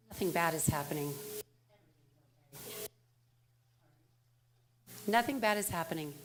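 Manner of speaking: be the calm angel on her shoulder. Retold or imitated. Angel side of her mind calming her down, softly reassuring. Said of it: "like the angel".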